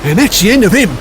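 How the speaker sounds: ordinary delivery, nothing marked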